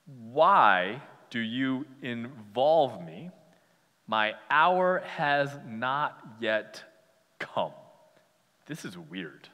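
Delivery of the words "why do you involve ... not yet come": slow, measured and detached, well apart from his mother; formal and distant. Retold or imitated. Jesus answering his mother, slow and formal. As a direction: draw out each word with cool formality. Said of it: "Jesus says to his mom".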